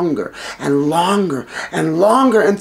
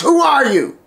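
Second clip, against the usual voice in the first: higher, sharp demand; angry demand; 6 semitones higher, 4 dB louder than usual. Acted. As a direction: challenge the intruder. Angry king confronts prisoner.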